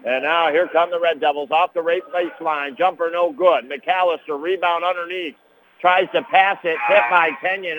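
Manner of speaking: brisk running game commentary; play-by-play call. Real, not acted. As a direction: call the action as it happens. Live basketball play-by-play call.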